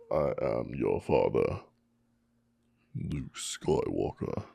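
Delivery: slow, low movie-villain rumble; lightly done Vader, slow and low; Darth Vader voice. Retold or imitated. Mic check as a slow, low Vader.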